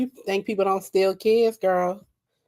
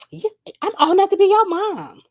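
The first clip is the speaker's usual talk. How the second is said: indignant retort; 12 semitones higher, 5 dB louder than usual. Retold or imitated. Nika retorting to teen boss.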